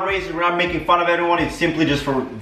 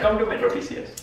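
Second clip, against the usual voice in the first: mock Indian accent; 3 semitones higher, 5 dB quieter than usual.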